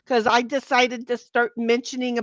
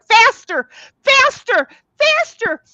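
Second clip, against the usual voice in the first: rising louder and higher, faster, faster; hammed-up urgent begging, climbing higher each time; mock urgent pleading; 11 semitones higher, 7 dB louder, slower than usual. Acted plea, rising each time.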